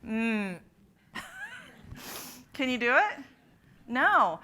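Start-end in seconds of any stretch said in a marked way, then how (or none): 0.1-0.6 "Mm" hummed through the nose; m sound. Shown as a demonstration. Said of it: "Say that sound and hold your nose". 1.1-2.5 short high laugh. Real, not acted.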